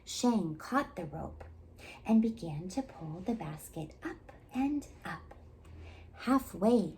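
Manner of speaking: ordinary delivery, nothing marked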